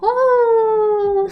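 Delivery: high, loud gasp of delight; real squeal at a cute puppy. Real, not acted. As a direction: bursts out squealing, then laughs. Sees adorable puppy photo.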